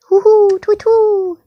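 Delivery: high, loud owl call; owl hoot. Pretend. Parent showing a child the owl's call, hooting.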